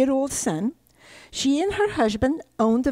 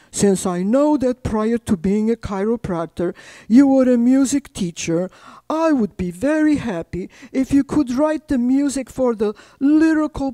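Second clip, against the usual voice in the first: reading a letter; 5 dB louder than usual. Retold or imitated. Reads Artur's letter aloud.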